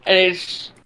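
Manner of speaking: ordinary talk, nothing marked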